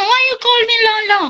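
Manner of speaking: high mock old man voice; imitating Lolo Boy. Teasing the old man, a high mock voice. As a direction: put on his voice, way up. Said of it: "Sabi mo".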